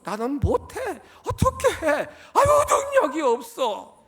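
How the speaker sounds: high, fretful voice of a doubter; mock whiny doubter. Preacher mimicking a doubting believer, high and whiny. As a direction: whine it like a believer who gives up.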